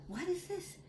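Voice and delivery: high, soft wondering question; surprised princess. Acted. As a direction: lift it high in quiet surprise. Princess discovers the baby.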